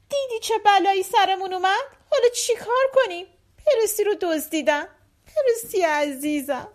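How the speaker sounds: high-pitched distress, slowing at the end; wailing lament. Acted. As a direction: moan over a stolen loved one. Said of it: "مادر قازها ناله کنان".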